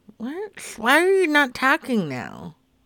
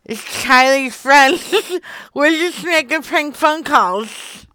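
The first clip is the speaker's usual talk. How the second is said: a touch louder, with a giggle midway; amused owning-up; giggly confession; 4 semitones higher, 5 dB louder than usual. Real, not acted. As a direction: admit the prank while giggling. Owns up to prank, giggling.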